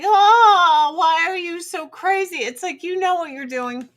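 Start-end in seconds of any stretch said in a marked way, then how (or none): 0.0-2.4 high, loud mock wail opening out into a complaint; mocking husband. Husband scolding wife for freaking out, high mocking wail. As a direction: imitate a husband wailing in exaggerated dismay. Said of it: "And then he's like".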